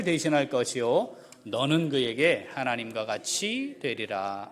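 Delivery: loud, measured Bible reading; scripture reading. Acted. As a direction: read the verse solemnly to the church. Reads scripture aloud.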